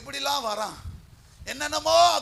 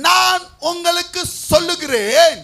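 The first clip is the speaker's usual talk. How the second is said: high, loud, stretched-out declaration; loud proclamation; 5 semitones higher, 10 dB louder, slower than usual. Real, not acted. Preacher echoing the reader's verse, loud drawn-out proclamation. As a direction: boom the verse out slowly.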